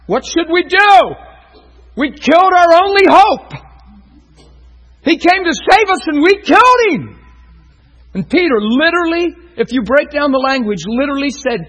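loud, high preaching at full force; fiery preaching. Acted. Pastor preaching to the congregation, loud and fervent. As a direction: pour it out over the congregation.

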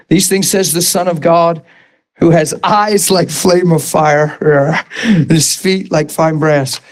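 scripture reading. Acted. Reads scripture aloud.

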